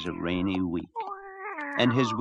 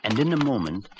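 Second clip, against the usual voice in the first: usual speaking voice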